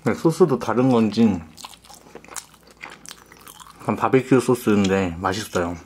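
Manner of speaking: chewing while talking; muffled through food; mouth full of burger. Real, not acted. Seen 1: bites burger at -1.0, lips pursed, cheeks full while speaking.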